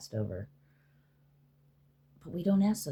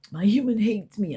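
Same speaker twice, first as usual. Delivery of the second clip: a lightly voiced sulky pet, higher and louder; petulant pet voice, pitched up; mock sulky pet; 8 semitones higher, 9 dB louder than usual. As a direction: play the ego whining about its owner.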